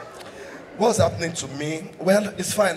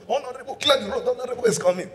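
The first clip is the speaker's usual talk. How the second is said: higher mock call to clear the road; security calling out; 8 semitones higher, faster than usual. Security for the honourable, a mock high call. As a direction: bark it like an escort guard.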